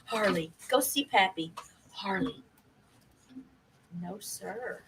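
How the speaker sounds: quiet aside to a pet off to the side; gentle hushed no to a misbehaving dog; hushed scolding to the dog. Real, not acted. Sending the dog away off-mic.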